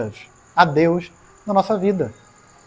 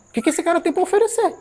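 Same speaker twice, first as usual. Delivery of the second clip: mocking question; 10 semitones higher, faster than usual. Mocking the bar friends.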